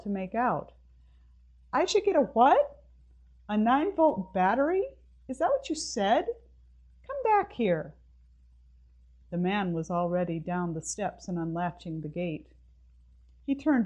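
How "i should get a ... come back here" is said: incredulous shouting after him. Acted. Mabel protests as the man leaves.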